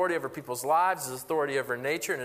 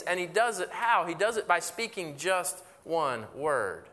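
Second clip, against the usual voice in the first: normal talking voice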